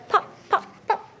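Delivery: high, popping with a laugh; cork popping. Shown as a demonstration. Teacher acting cork sounds for children. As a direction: pop the sound out playfully. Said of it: "He can go like a cork".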